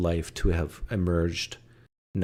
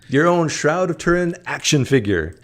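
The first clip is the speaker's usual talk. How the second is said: loud, high ad-pitch parody; mock toy advert; 8 semitones higher, 7 dB louder than usual. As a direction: hawk it like a toy commercial.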